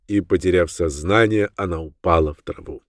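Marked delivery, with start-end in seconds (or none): none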